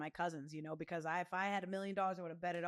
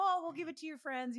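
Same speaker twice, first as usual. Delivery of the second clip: friendly neighbors; 8 semitones higher, 5 dB louder than usual. Retold or imitated. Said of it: "And they're like".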